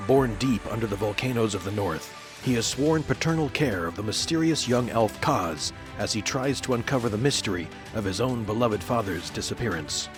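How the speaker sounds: usual speaking voice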